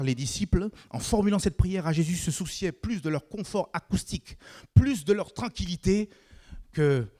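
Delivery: high, loud pulpit voice; rising sermon fervour; fervent preaching. Acted. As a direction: preach it out to the whole church. Preaching at full force.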